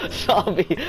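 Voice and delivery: normal talking voice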